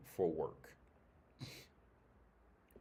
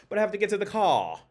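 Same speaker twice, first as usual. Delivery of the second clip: louder, higher mocking Australian accent, R on no; 10 semitones higher, 10 dB louder than usual. Mocking how Australians talk, in a hammed-up Australian accent. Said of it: "there's no R in no".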